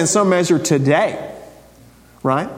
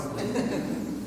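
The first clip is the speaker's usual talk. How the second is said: soft breathy laugh; 13 dB quieter than usual. Real, not acted. Laugh after the joke.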